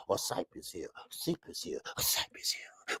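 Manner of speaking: whispering the name to himself while working it out; whispered. Real, not acted. Streamer quietly whispers a chat name to get it right, whispered. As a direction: sound out the name in a hushed whisper.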